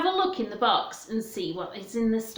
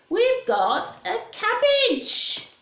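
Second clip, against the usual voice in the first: excited reveal; 10 semitones higher, slower than usual. Acted. Revealing vegetable to children.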